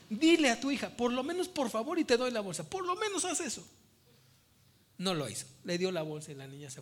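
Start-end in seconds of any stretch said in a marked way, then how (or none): 0.1-3.7 urging the dad. Pretend. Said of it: "Y yo estaba parado diciendo".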